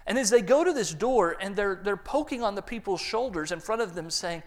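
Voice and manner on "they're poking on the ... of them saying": ordinary talk, nothing marked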